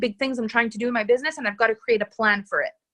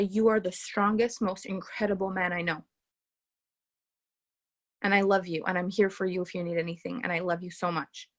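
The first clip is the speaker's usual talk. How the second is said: tender admiration; 4 semitones lower, 7 dB quieter than usual. Acted. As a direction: speak to him like you truly believe in him. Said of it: "holding space for him".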